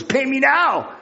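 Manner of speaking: high-pitched, acted angry demand. Creditor grabs his debtor by the throat, angry demand. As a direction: demand it forcefully, pitch jumping up.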